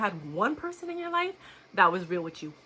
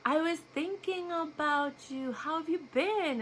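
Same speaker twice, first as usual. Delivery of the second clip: mimicking his slow talk; 8 semitones higher, slower than usual. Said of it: "I used to talk so slow".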